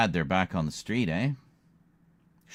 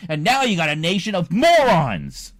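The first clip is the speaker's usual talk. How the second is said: angry shout; 11 semitones higher, 9 dB louder than usual. Real, not acted.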